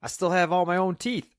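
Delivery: high, loud and oblivious; deaf man's loud voice. A narrator's reading. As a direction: proclaim it like someone hard of hearing. Deaf man answers at cross purposes.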